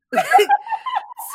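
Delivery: a real high-pitched laugh bursting out; real giggle fit, high and loud; high burst of laughter. Real, not acted.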